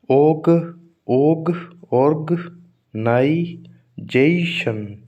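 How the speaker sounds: Organisation broken up very slowly, "जे" held long; syllable by syllable. Shown as a demonstration. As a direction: sound out organisation piece by piece, pausing between parts. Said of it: "तो जेशन में stress आएगा". (Organisation को टुकड़ों में, बहुत धीरे)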